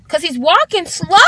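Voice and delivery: normal talking voice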